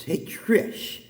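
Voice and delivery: soft call. Pretend. God calling names softly.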